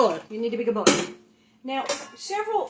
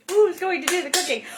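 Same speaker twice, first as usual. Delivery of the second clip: high, loud mock announcer call; show announcer; 7 semitones higher, 5 dB louder than usual. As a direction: call the title out to the crowd. Repeats the segment title.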